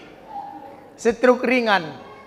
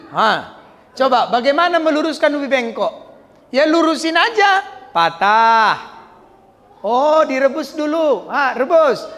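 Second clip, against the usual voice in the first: usual speaking voice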